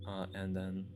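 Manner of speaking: ordinary delivery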